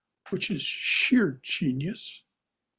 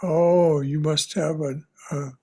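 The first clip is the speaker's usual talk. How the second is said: normal talking voice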